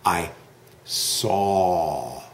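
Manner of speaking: saw with jaw down, aw long; open aw in saw. Shown as a demonstration. Teacher models saw with the open aw. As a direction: drop the mouth on saw. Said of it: "move your mouth down".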